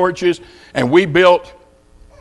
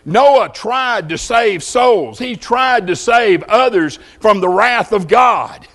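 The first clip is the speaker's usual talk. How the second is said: slow emphasis; slower than usual. Acted. Preacher stressing Noah's mission.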